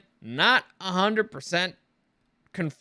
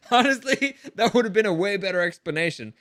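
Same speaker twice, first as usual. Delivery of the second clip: Honestly, much higher and louder; emphatic outburst; 4 semitones higher, 4 dB louder than usual. Real, not acted. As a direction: exclaim it in disbelief. Laughing at the bad explanation.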